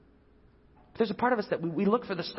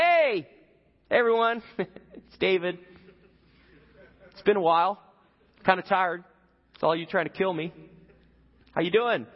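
much higher, louder breezy hello with a laugh; casual greeting; 4 semitones higher, 4 dB louder than usual. Retold or imitated. David hailing Saul's army from the cave, breezy mock hello. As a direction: stroll out like meeting old friends. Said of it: "comes out in the cave and is like".

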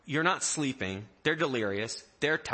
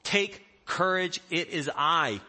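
take courage; 6 semitones higher, 5 dB louder than usual. Acted. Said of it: "Jesus said".

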